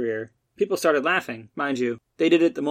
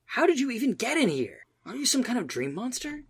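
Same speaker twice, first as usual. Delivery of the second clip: frightened high voice; 10 semitones higher, 4 dB quieter than usual. Acted. Matt frightened questions.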